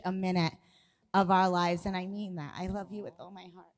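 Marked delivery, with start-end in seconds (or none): 1.8-3.7 soft, fast, choked-up words; almost too moved to get it out; emotional. Real, not acted. Wife telling her husband she loves him, emotional and soft. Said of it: "making me emotional now".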